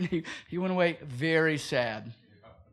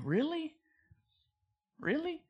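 incredulous; 9 semitones higher, 6 dB quieter than usual. Real, not acted. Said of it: "mocking preachers' claim".

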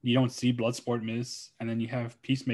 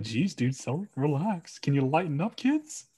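mock exasperated; 2 semitones higher, slower than usual.

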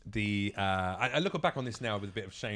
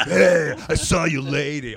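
slow, loud sleazy villain line; movie douchebag villain; 4 semitones higher, 10 dB louder, slower than usual. Retold or imitated. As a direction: leer at her like a cheesy film bad guy. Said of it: "the douchebag from the other band".

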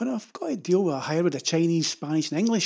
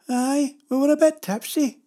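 higher, lightly put-on old woman's voice; a touch of tipsy mother-in-law, sheepish; 9 semitones higher, 4 dB louder than usual. Son-in-law voices his mother-in-law owning up to tipsiness, light higher imitation. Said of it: "says my mother-in-law".

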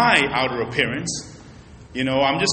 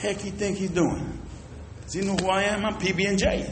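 offended grumble; 5 semitones higher, 8 dB quieter than usual. Retold or imitated. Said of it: "I felt disrespected".

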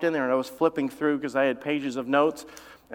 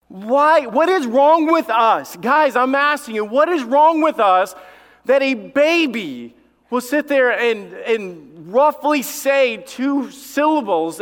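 high, loud mock exasperation; full-force preaching; 7 semitones higher, 6 dB louder, slower than usual. Real, not acted. Preacher asking parents about their baby craze, exasperated preaching. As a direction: demand an answer from the crowd, stretching key words.

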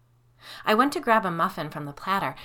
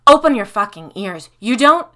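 louder, raging at them; furious outburst; 5 semitones higher, 17 dB louder than usual. Acted. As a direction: snap at them to listen. Furious woman cut off mid-rant.

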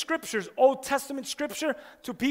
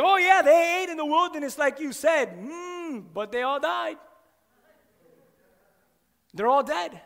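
high, mock-agreeing sarcasm with a drawn-out "Mm"; sarcastic; 5 semitones higher, 4 dB louder than usual. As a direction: play along, then drop the punchline.

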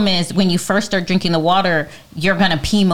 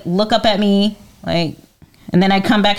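usual speaking voice

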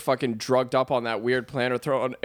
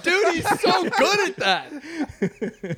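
laughing; much slower than usual. Real, not acted. Impressed, laughing at friend.

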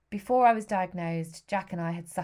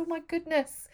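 nurse's dismay; 9 semitones higher than usual. Retold or imitated. Nurse reacting to sobbing.

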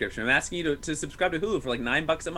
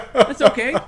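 "That's okay" said louder through a laugh; 7 semitones higher, 11 dB louder than usual. Real, not acted. Joking about necrophilia off screen.

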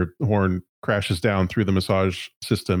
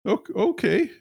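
high, nervous corporate stammer; a flustered high-pitched executive; 11 semitones higher than usual. Acted. GM holds executive voice.

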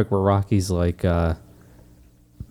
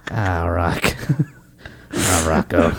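low, weary trainer's groan; hammed-up low, speechless cornerman; Mickey impression; slower than usual. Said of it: "And Mickey's just looking at him going".

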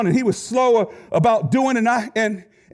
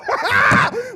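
high burst of laughter. Real, not acted. Laughs at own joke.

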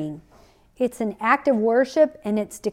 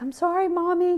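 higher-pitched, lightly voiced little girl; apologetic child; 8 semitones higher than usual. Little girl apologizing to her mother after spilling milk, lightly imitated. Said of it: "she was my child".

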